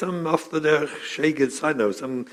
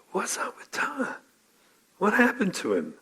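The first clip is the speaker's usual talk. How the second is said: puzzled whisper; 7 semitones higher, 6 dB quieter than usual. Retold or imitated. Daughter puzzled, voiced in story.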